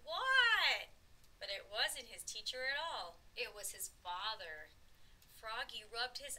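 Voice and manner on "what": very loud, high yell; startled holler back; shouted answer. A narrator's reading. Froggy answers as his teacher calls, shouting back.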